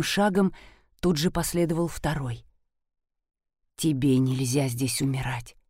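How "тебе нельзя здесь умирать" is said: deep, soft protective voice; low male voice. Acted. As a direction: say it low and close. Arne's low voice, distinct timbre.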